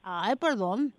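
talking with a mouthful of food; words round a bite of food; talking while eating. Real, not acted. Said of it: "Estás comiendo y hablando".

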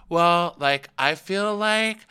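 high, drawn-out mock voice; exaggerated hesitant objection; whiny imitation. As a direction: stretch it out in a put-on whine. Mimicking Raquel in retold exchange.

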